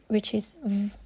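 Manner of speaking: ordinary talk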